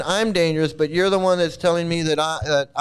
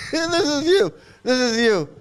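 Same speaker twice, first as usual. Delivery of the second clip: laughing; 6 semitones higher than usual. Real, not acted. Laughs showing the clip.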